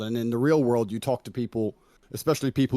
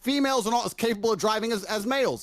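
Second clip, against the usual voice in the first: higher pitched, defiant insistence; insistent; 10 semitones higher than usual. Real, not acted. Defending his view to the streamer, defiant and insistent. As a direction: dig in on personal experience. Raised pitch assertive rant.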